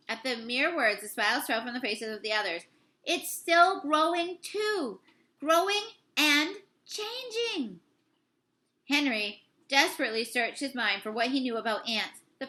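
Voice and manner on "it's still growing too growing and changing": higher, slow, rising alarm; alarmed revelation. A narrator's reading. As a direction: urge them, frightened.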